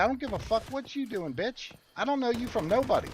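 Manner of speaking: high, mock furious comeback; retold angry retort. Father telling off a stranger touching his kid, high mock anger. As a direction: bite back at her fast. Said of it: "be like".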